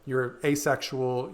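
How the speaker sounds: normal talking voice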